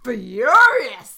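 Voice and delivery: drawn-out, hammed-up rage; furious. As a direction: stretch the words out in acted fury. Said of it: "I am standing and I am yelling".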